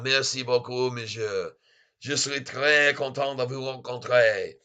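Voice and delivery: mock-suave French accent; playful French. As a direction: put on a courtly French voice. Playful French reply about his accent.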